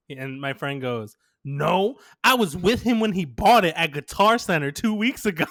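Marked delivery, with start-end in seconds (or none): 1.4-5.5 raised, emphatic voice tipping into laughter; loud mock protest. Teller imitates lying friend defending them, loudly insistent.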